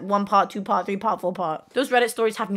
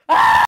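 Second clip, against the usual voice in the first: sudden shout; 11 dB louder than usual. Real, not acted.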